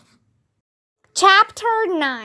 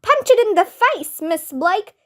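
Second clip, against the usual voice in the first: shout; 6 semitones higher than usual. A narrator's reading. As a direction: yell at her to fight back. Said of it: "shouted Ryan".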